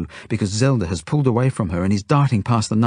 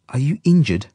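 raised, worried question; anxious; 6 semitones higher, 4 dB louder than usual. A narrator's reading. Genya asks the injured boy, anxious. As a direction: check anxiously on someone hurt. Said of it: "Genya asks me anxiously".

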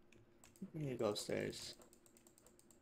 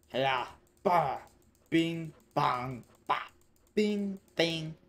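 loud, punchy mock hit sounds; shouted attack noises; 4 semitones higher, 9 dB louder, much slower than usual. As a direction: call out a burst of cartoon blows. Imitating blows while attacking.

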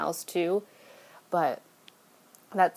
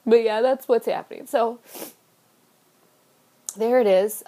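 blubbering; 5 dB louder than usual. Real, not acted. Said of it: "this is my ugly crying face".

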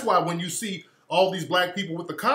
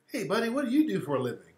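lightly imitated nosy stranger, higher and quick; quick, higher mock-friendly question; 6 semitones higher, faster than usual.

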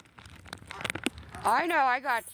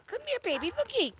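much higher sing-song pet voice; faster than usual. Real, not acted. Owner coaxing a timid dog closer in high baby talk.

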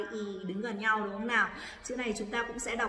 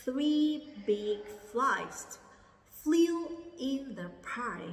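"Three big flies flew in the pie" dragged out word by word, much slower; very slow repeat; 3 semitones higher, much slower than usual. Shown as a demonstration. Teacher repeating very slowly so students can follow. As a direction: drag every word out. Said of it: "Lại lần nữa nha".

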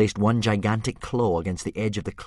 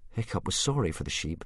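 usual speaking voice

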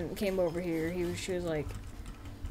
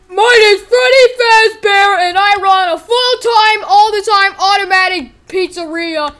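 high, loud ranting character shout; over-the-top yelled tirade; Freddy Fazbear; 9 semitones higher, 12 dB louder than usual. Pretend. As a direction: bellow a boastful introduction and threat. Freddy Fazbear bellows introduction and threat.

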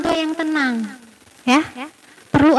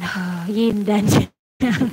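very slow calm; 7 semitones lower, 4 dB quieter, much slower than usual. Shown as a demonstration. Calming breathing demonstration.

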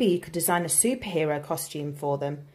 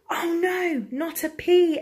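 high-pitched, drawn-out dismay; horrified gasp; 11 semitones higher, slower than usual. A narrator's reading. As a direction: gasp it high, as a shocked crowd. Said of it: "everyone gasped".

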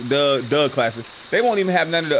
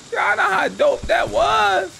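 hyped shout; much slower than usual. Acted. Repeats hype, now shouted.

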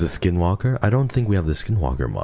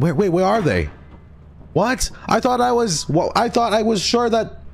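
shocked. Real, not acted. Friends missing, sudden panic.

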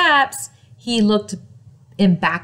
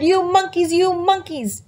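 scolding; 7 semitones higher than usual. Acted. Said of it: "he shook his finger".

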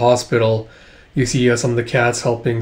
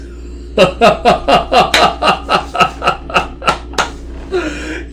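long high giggle; long laugh; 13 semitones higher than usual. Real, not acted. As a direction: laugh on and on in a rapid run. Laughter after the spies line.

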